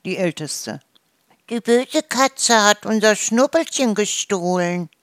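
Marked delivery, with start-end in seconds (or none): 1.5-4.9 high, agitated goose voice; chattering goose. A narrator's reading. Eldest goose reports the theft to the farmer, chattering. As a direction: wail it like an upset old goose. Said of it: "Dann schnatterte Gisela".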